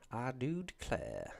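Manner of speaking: slow, soft mock Southern drawl; Southern American accent. Streamer quoting The Office, mock Southern accent. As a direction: stretch it out in a hammed-up Georgia twang. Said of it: "do a Southern American accent".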